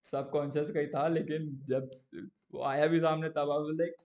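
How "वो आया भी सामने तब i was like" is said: ordinary talk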